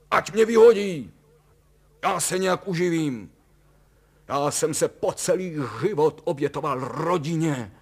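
loud, high-pitched ranting; outraged shout. A narrator's reading. Husband renouncing his job for the family, shouting. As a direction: rant at the top of the voice. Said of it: "rozkřikl se Kalous".

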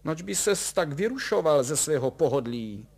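high-pitched scornful questioning; contemptuous. A narrator's reading. Wife belittling her comfort-loving husband, contemptuous. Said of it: "s jakýmsi opovržením".

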